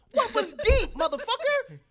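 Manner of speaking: high, loud exasperated yell; mocking yell. Real, not acted. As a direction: throw it back at them, incredulous. Mocking the fans' rant.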